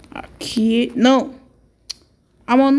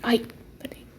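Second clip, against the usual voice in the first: whispering; 18 dB quieter than usual. Pretend. Creeping in the dark.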